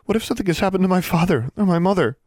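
put-on high, quick, worried girl's voice; anxious girl. A narrator's reading. Girl fearing for her father or mother, anxious. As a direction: rush it out high, fearing the worst. Pale Anna fears for her parents.

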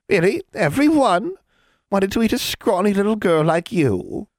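very high, pointed questions; mocking, incredulous hag. A narrator's reading. Hag to the girl who accused her, mocking disbelief. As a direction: challenge the girl with mock amazement.

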